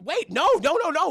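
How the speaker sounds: high, loud burst of no's; hammed-up panicked protest; alarmed objection. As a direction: cut in loudly to stop someone. Acting Anna's alarmed objection.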